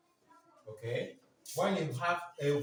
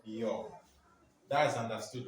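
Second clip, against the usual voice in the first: ordinary delivery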